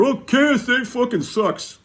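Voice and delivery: high, slow whiny mock voice; mocking whiny collector. Mocking forum complainers, whiny high mock voice. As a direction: sneer it like a know-it-all kid. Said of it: "You fucking guys that keep coming on here".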